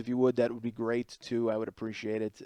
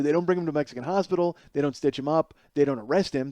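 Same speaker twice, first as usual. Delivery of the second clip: ordinary talk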